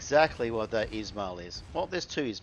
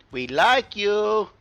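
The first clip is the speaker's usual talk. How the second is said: slow, high, loud teasing; mock sweetness; 11 semitones higher, 11 dB louder, much slower than usual. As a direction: stretch it out high and loud like a sarcastic pal. Taunting the enemy Vlad.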